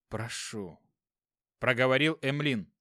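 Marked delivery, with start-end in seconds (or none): 0.1-0.8 weak and breathless. Acted. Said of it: "Он тяжело дышал".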